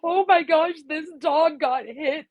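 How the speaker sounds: high, wailing mock sob; hammed-up distressed wail; mock tearful. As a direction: play up her own crying over the news. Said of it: "I'm like".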